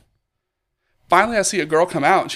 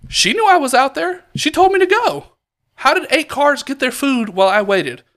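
much higher and louder, heated; venting at the counter staff, voice pitched up; retold angry complaint; 9 semitones higher, 4 dB louder than usual. Complaining to the counter staff about the wait, heated and high-pitched. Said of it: "And I was just like".

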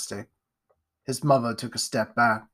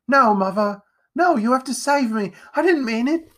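much higher, louder frantic begging; desperate pleading; 11 semitones higher, 6 dB louder than usual. Acted. Jack begging his mother to save him, frantic. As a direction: beg for your life.

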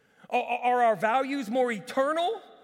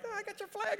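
high, soft teasing voice; light falsetto impression of a flag-grabber; mock zombie volunteer; 13 semitones higher, 7 dB quieter, faster than usual. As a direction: imitate them in a small, high voice. Imitating volunteer zombies grabbing flags.

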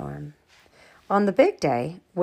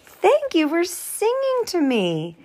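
higher, slower touched aunt; 11 semitones higher, 4 dB louder, slower than usual. Acted. Aunt Miranda thanking the girls, touched. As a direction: sound moved by the song.

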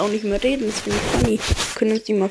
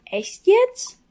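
very high incredulous squeak; can't-believe-it question; 12 semitones higher than usual. Real, not acted. Player hearing the spirit is called Horst, incredulous. Disbelief at the name.

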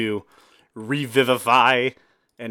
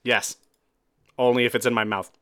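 normal talking voice

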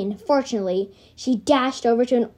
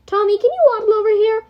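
high, loud and fast; calling out; 11 semitones higher, 7 dB louder, faster than usual. Acted. Cat calling injured Tommy over, loud and quick. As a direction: call across the room.

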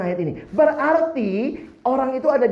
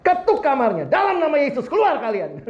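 commanding rebuke; 5 semitones higher than usual. Retold or imitated. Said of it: "Saya bilang".

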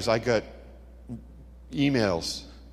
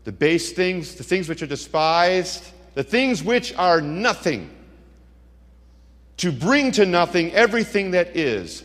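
higher, louder, slow preacher climb; preacher building to a scripture climax; preaching build-up; 7 semitones higher, 5 dB louder, slower than usual. Acted. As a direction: build each phrase like a pulpit crescendo.

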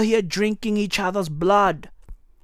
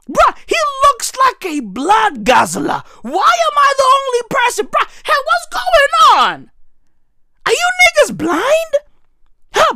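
shouted disbelief; 13 semitones higher than usual. Real, not acted.

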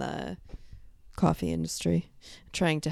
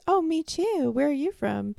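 high-pitched imitation of keen small talk; eager strangers; 11 semitones higher than usual. Imitating people meeting a New Yorker, high and eager. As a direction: mimic people piping up, much higher. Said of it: "people would go".

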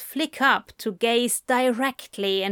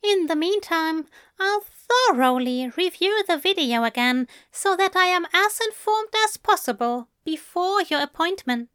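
higher, gentle English gentleman; Aziraphale voice; 8 semitones higher than usual. A narrator's reading. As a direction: lift the voice for the fussy angel.

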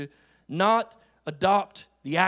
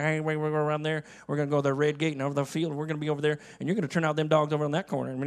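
put-on Joco country accent; 3 semitones lower, much faster than usual. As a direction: imitate hard-to-follow rural radio chatter in a country accent. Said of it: "they've changed their, their lingo".

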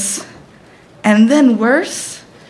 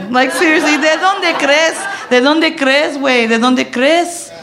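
sarcastic retort; 7 semitones higher than usual. Retold or imitated. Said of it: "she's like".

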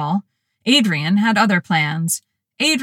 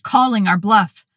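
plain reading voice

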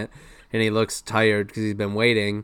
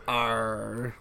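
pirate growl; 7 dB quieter than usual. Retold or imitated. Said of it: "SpongeBob goes".

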